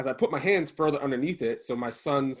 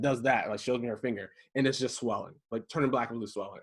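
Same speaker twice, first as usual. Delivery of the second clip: usual speaking voice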